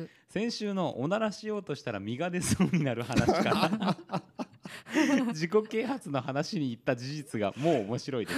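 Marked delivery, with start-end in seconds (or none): 4.0-5.2 high laugh breaking the reading; reading mail and cracking up. Real, not acted. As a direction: laugh mid-sentence.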